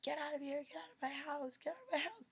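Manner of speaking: loud, high-pitched panicked yell; yelling out the window. Retold or imitated. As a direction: scream it out the window at someone. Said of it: "I was just yelling out the window".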